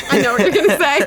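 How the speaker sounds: laughing as she speaks; amused, laughing line. Real, not acted. Laughing at the coming quote.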